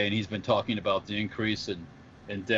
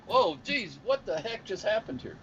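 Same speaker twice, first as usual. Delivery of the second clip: high-pitched comic disbelief; mock astonishment; 10 semitones higher than usual. Co-host acting his shock at an unexpected accent, mock astonishment. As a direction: react baffled, pitched way up.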